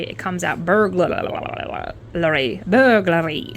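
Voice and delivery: put-on Scottish accent, burglary with rolled R's and L's; mock Scottish accent saying burglary. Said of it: "a Scots person try and say burglary".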